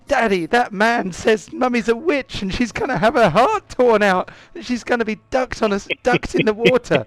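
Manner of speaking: put-on high, breathless child tattling; little girl telling daddy. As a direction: rush it out like a scared small girl. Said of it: "and she says".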